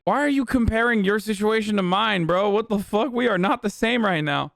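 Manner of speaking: indignant, high-pitched mock protest; pissed-off Tucker Carlson. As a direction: act outraged at being lumped in with him. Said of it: "I'd be so pissed".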